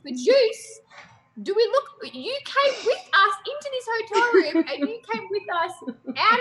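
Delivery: put-on high, fast New York accent, incredulous. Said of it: "your fly voice".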